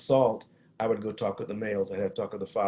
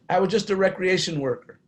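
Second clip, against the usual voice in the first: ordinary talk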